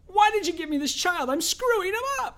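high, whining mock complaint to God; whiny high complaint. Believer complaining to God after a fight, high mock whine. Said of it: "the first fight comes".

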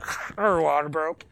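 slow, lightly voiced TV cop; a hint of a dramatic cop show; TV cop line. Preacher recalls a TV cop announcing the birth, lightly voiced. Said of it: "Poncharella's like".

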